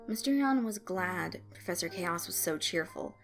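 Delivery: ordinary delivery, nothing marked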